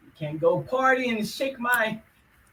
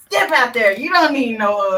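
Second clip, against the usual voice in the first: louder, cheering "Step out there"; loud hype cheer; 3 semitones higher, 9 dB louder than usual. Real, not acted. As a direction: call it out to the room. Hyping friend, louder repeat.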